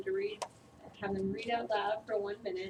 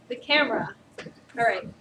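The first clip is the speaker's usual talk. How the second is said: louder, projecting to the back of the room; 8 dB louder than usual. Real, not acted. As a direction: push the voice out for the camera. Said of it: "so I gotta make sure I project for the".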